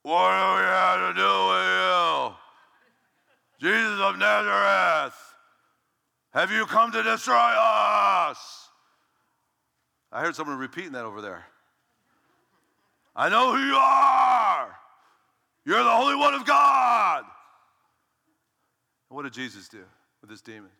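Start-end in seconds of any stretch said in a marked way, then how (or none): 0.0-8.7 loud, high, drawn-out demon shriek; slow, full-force possessed shouting; demonic voice. Shown as a demonstration. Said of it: "my best attempt at a demonic voice". 13.2-17.2 very high, drawn-out demon scream; loud, stretched-out demonic cry. Shown as a demonstration. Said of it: "my best attempt at a demonic voice".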